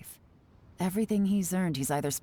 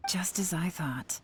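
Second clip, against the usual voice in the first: hushed whisper to herself; whispered aside; 3 dB quieter, slower than usual. Acted. Hero confirming a suspicion, whispered aside. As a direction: drop to a secret whisper.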